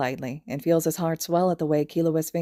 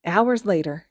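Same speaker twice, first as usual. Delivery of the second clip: plain reading voice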